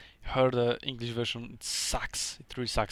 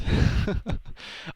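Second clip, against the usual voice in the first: embarrassed laugh; 8 semitones higher than usual. Real, not acted. Can't do the Dutch accent.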